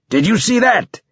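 high, exultant battlefield roar. A narrator's reading. Borges to his squadmate after the kill, roaring.

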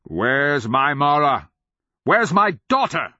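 put-on a frantic father shouting for his child; desperate demand. A narrator's reading. Father seizes madman, demanding daughter.

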